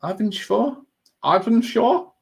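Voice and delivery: Arvinsjaur with a put-on Swedish accent, rising like a question. Shown as a demonstration. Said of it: "Trying to put the accent on".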